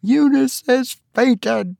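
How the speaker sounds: high, slow urgent call; urgent announcement. A narrator's reading. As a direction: alarm the servant. Tabitha calls to the servant.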